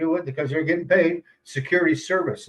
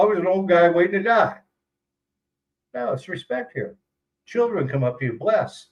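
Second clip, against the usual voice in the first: usual speaking voice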